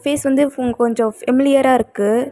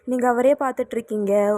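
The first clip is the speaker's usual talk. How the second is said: ordinary delivery, nothing marked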